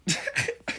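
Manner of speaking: short quiet unvoiced chuckle; a little laugh under the breath; airy laugh at her own joke; soft breathy laugh. Real, not acted.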